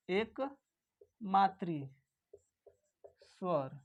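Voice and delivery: एकमात्री drawn out very slowly, syllable by syllable; teacher spelling out एकमात्री at a crawl; slow dictation. Shown as a demonstration. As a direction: say एकमात्री slowly so the class can write it. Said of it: "इसे हम लोग क्या दूसरे नाम से जानते हैं?".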